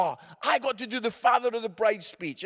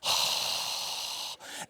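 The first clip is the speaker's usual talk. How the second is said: long exaggerated exhale; long breathy sigh; 7 dB quieter than usual. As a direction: let out a drawn-out sigh. Mocking the reaction to the song.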